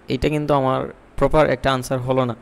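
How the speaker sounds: ordinary delivery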